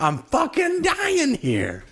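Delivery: echoing Tim Roth's dying line; imitating anguished cry. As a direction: drag it out like he's bleeding to death. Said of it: "just hearing him deliver that".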